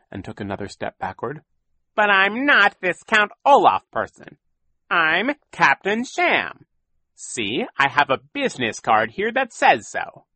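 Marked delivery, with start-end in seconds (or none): 1.9-10.3 high, loud sneering villain; smug villain in disguise; Count Olaf voice. A narrator's reading. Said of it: "Count Olaf admitted".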